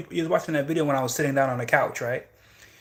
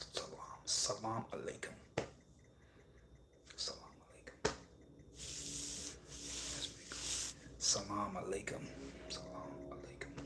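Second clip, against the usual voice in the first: whispered practice; 7 semitones lower, 20 dB quieter than usual. Shown as a demonstration. Rehearsing the greeting alone, in a whisper.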